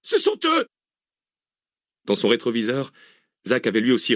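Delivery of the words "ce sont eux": very high-pitched shriek of alarm; screaming. A narrator's reading. Passenger spotting the pursuers' car behind, screaming. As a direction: scream it as if to break your voice. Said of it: "hurla à s'en briser les cordes vocales".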